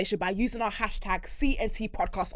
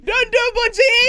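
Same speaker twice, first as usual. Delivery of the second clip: high, loud mock preaching; imitating a pastor; 10 semitones higher, 7 dB louder than usual. Host repeats pastor's warning, loud mock preaching. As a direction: exhort like a fired-up pastor. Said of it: "that's what my pastor says".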